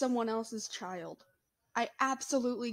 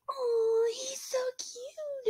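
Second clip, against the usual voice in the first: put-on high, slow cooing old lady; imitating a gushing old lady; much slower than usual. Old lady at Walmart cooing at a baby, high and slow. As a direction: coo it high and drawn out like a doting grandma. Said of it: "she's like".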